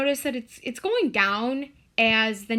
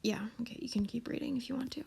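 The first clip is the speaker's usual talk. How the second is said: whisper; 11 dB quieter, much faster than usual. Real, not acted. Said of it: "whispered aside".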